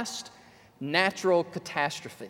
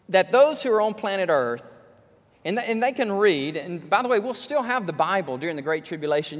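normal talking voice

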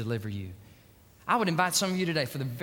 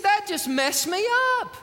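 high shouted cry of being undone; shouted confession; 6 dB louder than usual. Acted. Preacher voicing Hemphill to the congregation, shouted. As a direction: shout it out from the pulpit.

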